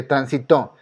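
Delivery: transitó landing on TÓ; stress on last syllable. Shown as a demonstration. Teacher shows where force falls in transitó, last syllable stressed. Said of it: "aquí cae la fuerza".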